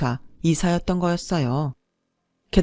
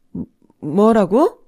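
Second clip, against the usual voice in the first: slow stammering shock; can't believe what she just heard; stunned disbelief; 4 semitones higher, 6 dB louder, much slower than usual. A narrator's reading. Mother hearing the wedding is off, stunned stammer.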